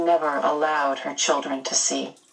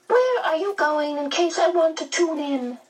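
high, fluting medium voice; high-pitched, chatty medium; inquisitive medium; 11 semitones higher than usual. A narrator's reading. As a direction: ask curiously, well up in pitch.

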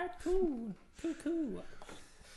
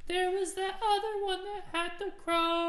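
excited rant; 4 semitones higher, 12 dB louder than usual. Acted. Excited rant over crow.

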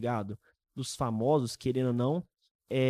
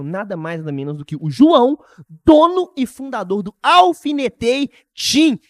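hype announcement; 4 semitones higher, 13 dB louder than usual. Real, not acted.

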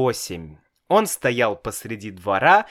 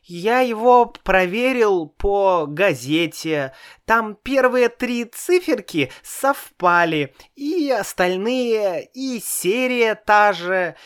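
put-on high little boy's voice, much higher; child voice; 8 semitones higher than usual. A narrator's reading. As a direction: play a small boy, innocent. Child explains proudly.

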